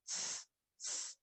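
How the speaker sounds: hissed s sounds, unvoiced; whispered s hiss. Shown as a demonstration. Teacher demonstrating the letter sound, a soft hiss. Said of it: "same sound as the J".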